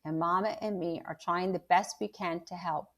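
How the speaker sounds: reading a chapter aloud to the class; steady storyteller voice, acting out the quotes; story narration. A narrator's reading. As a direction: read aloud to students. Teacher reads novel to class.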